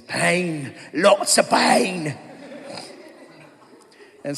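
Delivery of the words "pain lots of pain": slow, drawn-out line through a laugh; milk it like the little guy from the film; slow comic impression of a movie character; movie quote impression. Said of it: "seen A Knight's Tale ... that little dude".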